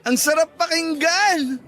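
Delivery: joyful outburst. Acted. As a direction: burst out happy, then savor the words. Joyful after the proposal is accepted.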